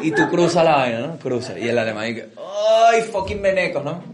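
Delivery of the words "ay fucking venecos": mock German complaint.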